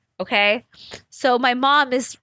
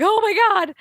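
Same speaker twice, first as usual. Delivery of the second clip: panicked mom; 10 semitones higher than usual. Retold or imitated. Mom freaking out.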